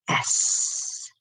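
long voiceless serpent hiss; soft drawn-out hissing sound; snake hiss. Acted. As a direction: let the sibilant trail away like a snake. Snake poem ends on hiss.

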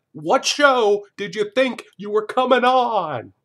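loud, high, drawn-out disbelief; mock outrage. Host berates the offended contestant, in loud mock outrage. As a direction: holler it slowly, stretching each phrase.

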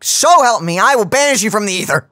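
mock threat. Teasing her friend over lost car keys, a hammed-up threat. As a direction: play the offended astrologer banishing her.

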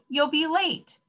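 high-pitched motherly call. A narrator's reading. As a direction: call it out high to hurry him. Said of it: "his mother called".

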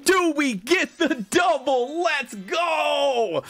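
high, drawn-out excited cheer; excited hype. Real, not acted. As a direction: stretch the words out with rising excitement. Hyping a possible double pull.

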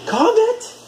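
high, ringing call to the dog. Real, not acted. Owner calling her dog by name, high and bright. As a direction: sing his name out high to fetch him.

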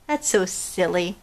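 plain reading voice

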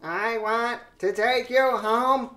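slow, stiff robotic Hillary. Pretend. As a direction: metallic voice. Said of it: "Why did you sound like a robot?".

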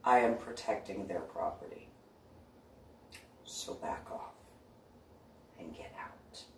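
soft menacing order fading to a whisper; quiet menace, trailing to breath; whispered threat to leave; low threat. Retold or imitated. Ordering intruder off property.

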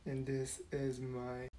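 barely audible shy whisper. Acted. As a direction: whisper it, too shy to speak up. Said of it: "why is she whispering?".

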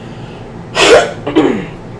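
throat clearing. Real, not acted. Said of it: "I'm sorry I keep doing that".

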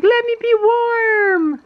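high, slow pleading little dog voice; high slow puppy voice; voicing the dog. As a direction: whine it like a cold puppy. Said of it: "Toby's like".

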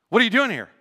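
sudden loud, high retold demand; mock boss's challenge. Manager walks in on boys killing time, imitated loud challenge. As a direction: imitate a boss catching idlers.

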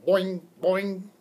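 lightly voiced frog boing; frog reply. A narrator's reading. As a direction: bounce it out like a pet frog. Froggy friend's croaking reply.

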